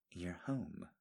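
soft, drowsy and sleepy; sleepy murmur. Acted. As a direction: murmur it as if just waking. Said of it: "she said sleepily".

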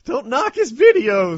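high, drawn-out yell; loud outburst. Acted. Defending friend's videos to co-hosts, loud high yell. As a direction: yell it in mock outrage.

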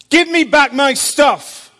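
loud, high, slow and firm; loud slow demand. Retold or imitated. Jesus repeating his demand to Graham, slow loud insistence. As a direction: spell out every word of the command.

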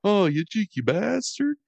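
mock British accent, pitched high, "cheeky bastard" sung up; Valley boy faking a British accent, pitched high. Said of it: "he's in Valley Girl, like".

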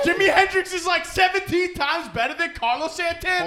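high, loud outraged yell; heated shouting. Real, not acted. Heated [name] vs [name] argument.

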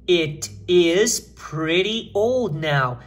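each word drawn out separately; very slow model reading. Shown as a demonstration. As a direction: read it out very slowly for learners. Lê a frase modelo por extenso. (leitura modelo bem lenta, palavra por palavra)

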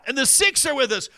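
high, projected rally proclamation; rally speaker. Retold or imitated. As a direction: imitate a speaker rousing the crowd. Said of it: "so Parker goes".

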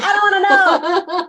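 high, loud cry of refusal; mock squeamish protest. Eater begs not to hear about ox heart, mock squeamish protest. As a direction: shriek it out in playful horror.

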